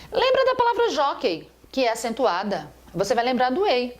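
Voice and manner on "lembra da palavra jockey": bright playful nudge to remember; playful reminder. Shown as a demonstration. Teacher playfully reminding students of a memory trick, much higher. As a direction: pitch jumps way higher on Lembra.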